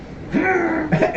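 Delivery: bursting out laughing; sudden high giggle. Real, not acted. Player laughing at table.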